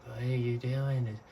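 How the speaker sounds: a soft, lightly put-on confused pet voice; voicing the dragon. Owner voicing her dragon's confusion at the new tank, soft and puzzled. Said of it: "He's like".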